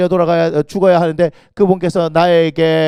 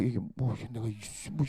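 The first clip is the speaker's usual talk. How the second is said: confused mumble; 7 semitones lower, 18 dB quieter than usual. Retold or imitated.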